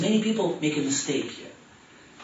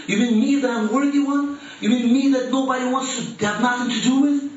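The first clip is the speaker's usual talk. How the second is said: higher, pleading disbelief; incredulous disbelief; 6 semitones higher than usual. Retold or imitated. Recalling being called by Jesus, higher and incredulous. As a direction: ask it as if you can't believe you're invited. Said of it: "There was a time when I said".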